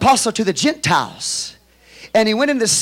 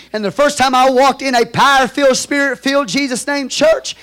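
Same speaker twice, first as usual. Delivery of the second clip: raised, higher, louder preaching cadence; full-force preaching; 7 semitones higher, 7 dB louder than usual. Acted. Pastor testifies about his first spirit-filled church, preaching loud. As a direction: drive the testimony home.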